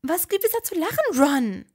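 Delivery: high indignant Hermine. A narrator's reading. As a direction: snap back offended. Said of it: "empörte sich Hermine".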